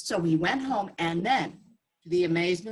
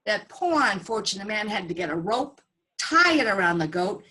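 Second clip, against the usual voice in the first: ordinary delivery, nothing marked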